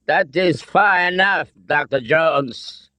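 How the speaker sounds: put-on high, commanding German-accented colonel; thick German accent. A narrator's reading. Nazi colonel stops Jones, thick German accent. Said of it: "his thick accent".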